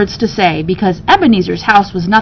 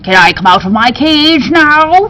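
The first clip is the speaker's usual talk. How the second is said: higher, louder, impatient little bird; eager parrot; 6 semitones higher, 4 dB louder than usual. Acted. Parrot in his cage begging to come out. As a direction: ask it like a bird itching to fly.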